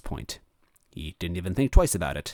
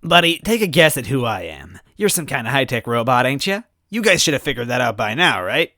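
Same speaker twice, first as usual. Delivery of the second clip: higher, louder chummy teasing; teasing trickster; 6 semitones higher, 7 dB louder than usual. Acted. Grey toad teasing robot with an arm round him. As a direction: play buddy-buddy, mocking.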